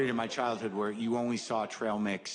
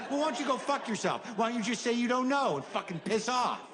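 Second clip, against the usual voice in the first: angry high retort; 9 semitones higher than usual. Retold or imitated. Quarrel with coworker.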